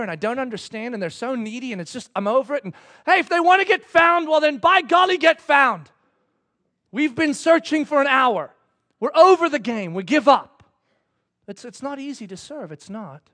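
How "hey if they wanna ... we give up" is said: loud, high outburst of giving up; hammed-up frustrated tirade; exasperated rant. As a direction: shout it out, fed up. Fed-up searchers in hide and seek.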